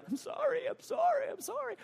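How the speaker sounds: put-on very high, tiny crying toddler voice; crying child. Crying toddler apologising to the father, high imitated whimper. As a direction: plead like a tearful baby, imitated in a squeaky pitch. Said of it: "they're crying and they're like".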